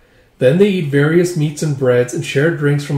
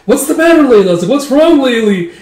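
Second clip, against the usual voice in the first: high, loud acted concern; alarmed questioning; 10 semitones higher, 7 dB louder than usual. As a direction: cry out in mock alarm. Alarmed grooms ask crying bride.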